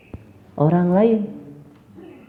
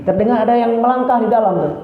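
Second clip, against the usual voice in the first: ordinary talk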